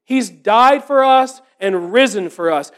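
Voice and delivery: ordinary talk, nothing marked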